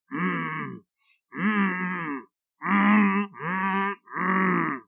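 muffled humming with jaws stuck; mumbling through stuck jaws. Acted. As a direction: hum with mouth glued shut. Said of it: "he muttered".

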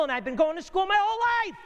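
high, fast comic wail; hammed-up exasperated squeal; mock exasperation. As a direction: cry it out in high-pitched comic protest. Mock complaint about endless schooling.

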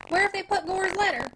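sharp demand, pitched a little up; old sharp way. A narrator's reading. Father sharply asks his son for the letter. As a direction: snap out the question, lifted. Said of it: "said in his old sharp way".

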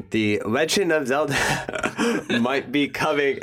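breaking into giggles mid-sentence; laughing through words. Real, not acted. Host botching a smooth story transition, laughing. Cracks up at own segue.

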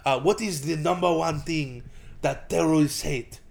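mock Israeli instructor, slowing at the end; Israeli accent. Trainer quizzing on self-defense, mock Israeli accent. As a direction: quiz them in an imitated Israeli accent. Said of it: "he was playing the Israeli".

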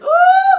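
high, loud whoop of excitement; joyful woo. Real, not acted. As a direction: let out a big preacher's whoop. Preacher's exclamation in full flow.

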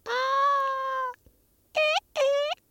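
very high sung vocal run; mock diva high note. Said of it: "You like my vocals?".

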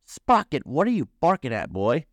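a touch of laughing surprise; amused. A narrator's reading. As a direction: ask the dog with mild amusement. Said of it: "Doc laughed".

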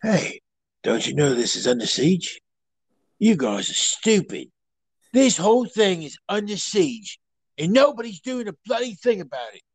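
mock-tough Cockney; hammed-up London Cockney telling people off; Cockney accent. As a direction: do an exasperated Cockney movie tough. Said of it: "pretty much how Cockneys talk".

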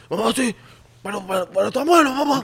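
high mock kid voice; put-on little kid defending himself; protesting child. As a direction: whine back at mom in a child's voice. Plays child answering mother.

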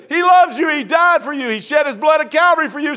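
high, rapid-fire retold gospel shout; shouted street preaching. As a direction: overpower her with a shouted proclamation. Preaches over her repeated curse.

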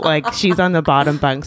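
ordinary talk, nothing marked

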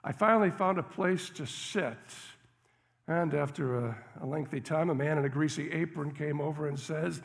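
normal talking voice

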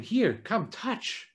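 soft coaxing, touch almost breathed; a soft, breathy invitation to come closer; gentle invitation. Retold or imitated. Jesus inviting Thomas to touch, soft and gentle. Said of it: "with a gentle experience".

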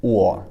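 "O" as u and o put together, very froggy; maximum frogginess. Shown as a demonstration. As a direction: glide froggily from u into o for "O". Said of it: "like that".

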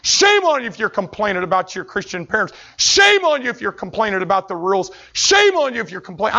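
loud, high preacher's rebuke; preaching full force shame on you; loud scolding. Real, not acted. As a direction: call them out with force.

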